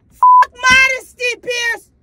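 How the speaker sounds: slow, high and loud refusal; defiant outburst. Real, not acted. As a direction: throw the word back at him. Defiant retort to Pierce.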